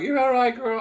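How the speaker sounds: high-pitched checking on the dog; retold pet talk. Retelling talking to sick dog.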